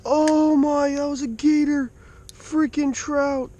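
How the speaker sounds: real disbelief after the gator; stunned, exasperated cry; shocked outburst. Real, not acted. Gator strikes his trout.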